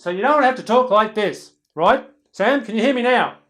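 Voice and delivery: very loud, high over-projected shout; yelling. Shown as a demonstration. Teacher showing Sam what too loud is, yelling. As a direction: blast it at the back of the room. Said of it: "So I'm yelling at you".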